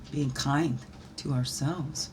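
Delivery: ordinary talk, nothing marked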